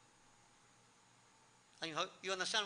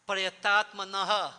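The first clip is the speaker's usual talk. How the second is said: "Prayatatmanaha" slow and loud; emphasized word; 6 dB louder, slower than usual. Real, not acted. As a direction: draw out "Prayatatmanaha" with weight. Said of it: "I like to emphasize that last word".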